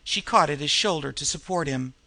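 plain reading voice

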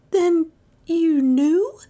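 stunned and faltering; stammered. A narrator's reading. Dale to her aunt, stunned and stammering. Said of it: "she stammered".

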